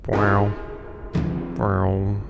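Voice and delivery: normal talking voice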